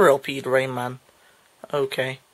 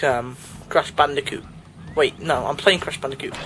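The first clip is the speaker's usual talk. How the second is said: ordinary delivery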